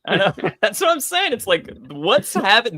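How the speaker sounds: normal talking voice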